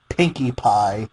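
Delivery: usual speaking voice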